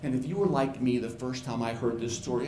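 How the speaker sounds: ordinary delivery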